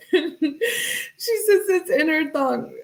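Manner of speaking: slow, high, giggling delivery; laughing while talking. Real, not acted. Rereading the text to viewers, laughing. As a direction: speak through the giggles.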